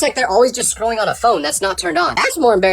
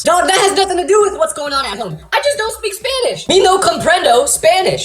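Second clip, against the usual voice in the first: high-pitched, hammed-up indignation; exasperated protest; 8 semitones higher than usual. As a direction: plead it out in comic frustration. Exasperated comeback to the teacher.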